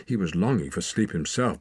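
ordinary delivery

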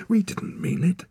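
high, pleading protest; desperate. A narrator's reading. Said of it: "Torak said desperately".

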